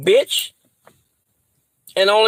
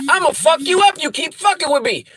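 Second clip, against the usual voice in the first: angry threat; 7 semitones higher than usual. Real, not acted. Threatening the woman who called.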